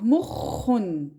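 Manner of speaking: mukhun drawn out slowly, syllable by syllable; slow Arabic word. Shown as a demonstration. Teacher models the Arabic word slowly for students. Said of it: "vous voyez, shadda".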